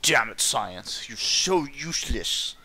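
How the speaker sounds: loud, drawn-out mock frustration; mock exasperation. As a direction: echo the game character slowly, hammed up. Mockingly echoes the game's line.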